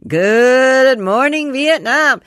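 loud, drawn-out radio host call; radio DJ shout. Pretend. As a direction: boom it out like a morning DJ. Shouted movie catchphrase impression.

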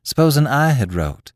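ringing out. A narrator's reading. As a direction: let the thought ring out aloud. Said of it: "Her voice rang out quite loud".

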